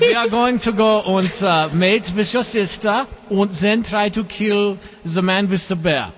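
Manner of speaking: exaggerated German villain accent. Shown as a demonstration. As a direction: declaim in a hammed-up German accent. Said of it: "a better German accent".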